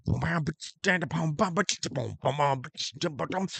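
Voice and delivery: beatboxed bass and drums; imitated beat. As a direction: boom out the backing beat by mouth.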